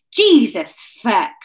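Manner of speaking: slow startled exclamation; startled cry. A narrator's reading. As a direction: cry out in shock, West Country style. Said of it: "Ryan cried at the sight".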